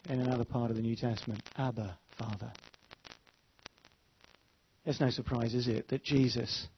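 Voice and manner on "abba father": quiet prayer. Shown as a demonstration. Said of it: "those words".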